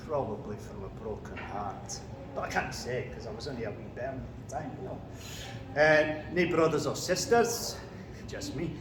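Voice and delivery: put-on Macbeth in a Scottish accent; broad Scottish accent. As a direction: speak as a Scottish warrior king, Scottish accent. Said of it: "Do you want a quick example?".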